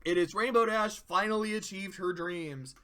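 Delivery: ordinary talk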